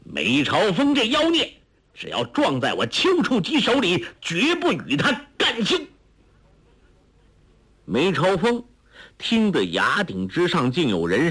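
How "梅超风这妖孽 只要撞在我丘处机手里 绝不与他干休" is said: high, resounding proclamation; high-pitched, bold vow against an enemy; ringing declaration. A narrator's reading. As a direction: declare it as a threat meant to be overheard. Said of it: "朗声说道".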